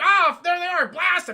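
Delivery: high, loud alarm call; stormtrooper shout. Acted. Stormtrooper spotting the ship, shouting to his squad. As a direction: yell the order to open fire. Acted stormtroopers opening fire.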